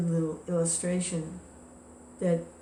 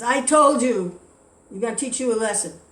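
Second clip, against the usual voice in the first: loud, high outburst as he strikes; angry shout; 5 semitones higher, 9 dB louder than usual. Retold or imitated. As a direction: yell it while hitting. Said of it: "he's really angry".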